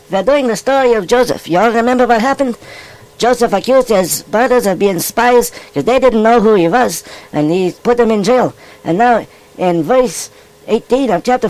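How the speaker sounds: steady, storytelling Bible reading; scripture story reading. Acted. Rabbi reads Genesis to the boys and girls, storytelling read. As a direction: read the Joseph story aloud to young listeners.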